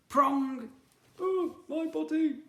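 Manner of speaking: high, loud yelp of pain; comic cry of pain. Acted. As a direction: squeal as if jabbed in the bottom. Fork seat pain.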